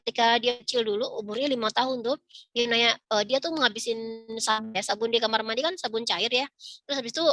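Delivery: ordinary talk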